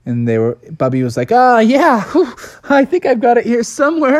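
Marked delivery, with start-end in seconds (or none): 1.3-4.2 nervous stalling. Acted. Bubby sweating, searching for pizza.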